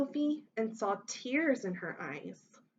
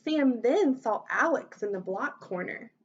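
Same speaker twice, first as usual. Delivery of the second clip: ordinary delivery, nothing marked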